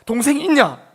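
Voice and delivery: high, loud exasperated protest; indignant brothers shouting; sons protesting. Retold or imitated. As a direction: throw the blame back, loudly. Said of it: "아들들이 대답합니다".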